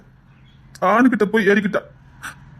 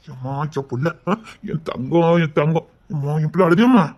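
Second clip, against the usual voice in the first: tender cooing; 6 semitones lower, 3 dB quieter than usual. Acted. Father cooing to crying baby.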